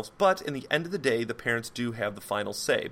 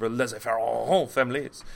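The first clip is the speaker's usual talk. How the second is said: put-on French accent; 4 dB louder than usual. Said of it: "whenever I say French word, I must say with French accent".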